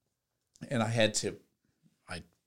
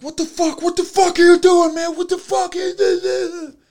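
much higher, louder furious shout; imitating furious yelling; 11 dB louder than usual. Rammed driver yelling at the man who hit him, furious high shout. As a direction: mimic the rammed driver screaming at you. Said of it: "And he's like".

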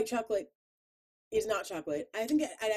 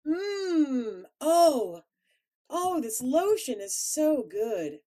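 slow mock indulgent; 7 semitones higher, 8 dB louder, much slower than usual. Pretend indulging in lotion.

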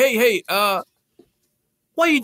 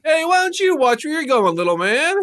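shouting; 6 semitones higher than usual. Acted. Collision quarrel in the street.

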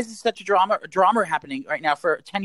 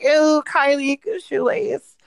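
high whiny mocking voice; mock disgusted fan; 11 semitones higher, much slower than usual. Mocking people who dismiss Kylie's products, high and sneering. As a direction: sneer it like a snobby critic. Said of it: "and people be like".

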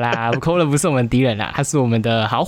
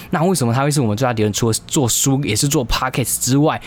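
ordinary delivery

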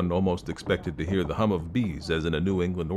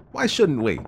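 put-on louder, higher young man's urging; 8 semitones higher, 5 dB louder than usual. A narrator's reading. Young man presses the girl to race, urging. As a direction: press her, voice pitched up. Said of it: "urged the young man".

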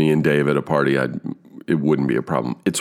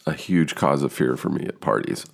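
usual speaking voice

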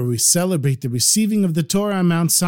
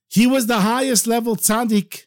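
ordinary delivery, nothing marked

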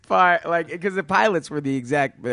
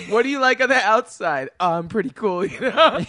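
dating profile voice; 7 semitones higher, 6 dB louder than usual. Pretend. Acting Kevin on a VR date.

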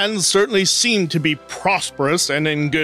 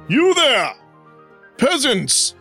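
high, falling royal hail; imperious calling out; 5 semitones higher, slower than usual. Acted. King calls to peasants from his coach, imperious. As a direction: summon them like a lordly king.

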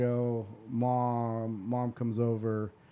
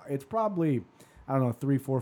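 normal talking voice

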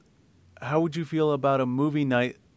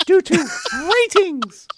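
put-on very high, loud girly falsetto; Colt as mock girly; 6 dB louder than usual. Co-host mocking a listener while reading his question, squeaky girly. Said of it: "make it sound girly as shit".

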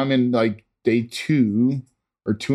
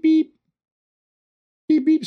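sing-song machine beeping; imitated monitor beep. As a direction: mimic the oxygen alarm going off. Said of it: "I start hearing beep".

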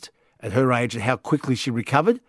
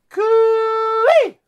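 long high bush cooee; cooee call; 3 dB louder than usual. Acted. Calling out to a listener.

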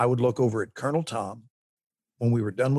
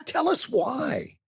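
high, far put-on voice of an eager interviewer; hammed-up eager interviewer voice; mock eager host; slower than usual. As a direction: play the host begging to know, way up high. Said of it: "Sure, [name]".

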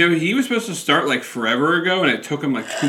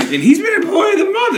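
laughing shout; 6 dB louder than usual. Real, not acted. Laughing outburst.

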